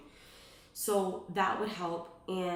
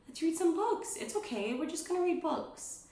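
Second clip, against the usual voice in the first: put-on soothing toddler talk; 10 semitones higher than usual. Shown as a demonstration. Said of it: "soothing the child on potty".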